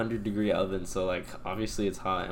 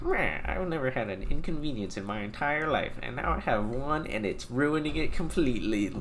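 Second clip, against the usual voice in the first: exaggerated mock complaint, slightly raised pitch; mocking whiner; 4 semitones higher than usual. Manager making fun of mask refusers, mock whining. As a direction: whine like an overdramatic customer. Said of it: "making fun of people who don't wear masks".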